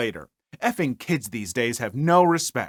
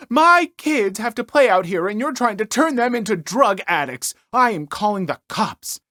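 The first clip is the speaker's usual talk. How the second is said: outraged Karen; 6 semitones higher, 4 dB louder than usual. Retold or imitated. Karen threatens to call cops.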